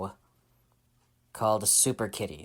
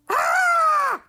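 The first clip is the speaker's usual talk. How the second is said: loud, high-pitched comic scream; scientists' scream; 9 dB louder than usual. Acted. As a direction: scream like a roomful of panicked scientists. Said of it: "he heard".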